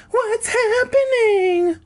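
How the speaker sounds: high drawn-out sleepy voice; groggy, just woken up. A narrator's reading. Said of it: "she asked sleepily".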